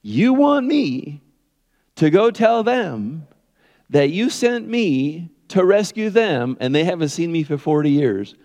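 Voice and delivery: high, drawn-out complaint; whining Moses. Acted. Preacher playing Moses objecting to God's mission, slow high whining. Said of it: "he's whining and he's complaining".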